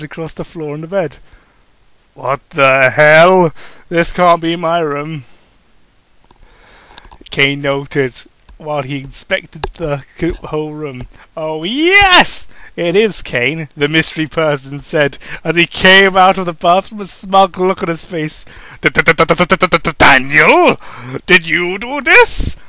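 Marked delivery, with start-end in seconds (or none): 2.1-5.2 loud, drawn-out disbelief, then settling; a man walking into a surprise he didn't expect; shocked outburst. Acted. Kane finds room full of candles. 11.4-13.7 a touch of a hammed-up smug tease; smug greeting. Mystery person greets Kane smugly. 18.8-22.6 loud, stuttering disbelief, slow on the question; hammed-up stutter of surprise; stammering shock. Kane stunned to see Daniel.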